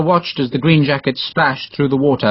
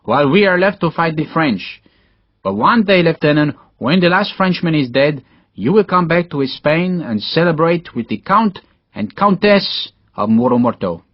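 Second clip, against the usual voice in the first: higher-pitched Spanish officer making a heartfelt promise; 5 semitones higher than usual. Acted. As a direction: speak as a proud Spanish nobleman inviting a comrade.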